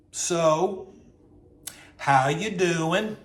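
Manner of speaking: slow, drawn-out chatty greeting; Southern lady. Retold or imitated. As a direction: stretch the question out after an awkward stare. Server leans in, drawn-out greeting.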